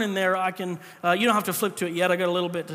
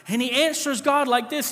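usual speaking voice

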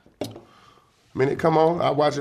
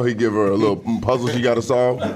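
ordinary talk

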